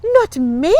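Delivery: put-on high, loud girlish protest. A narrator's reading. Fanchon refuses to face Bugs, protesting. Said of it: "Fanchon protested".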